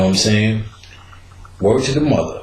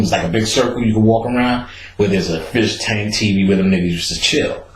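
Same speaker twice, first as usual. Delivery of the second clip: normal talking voice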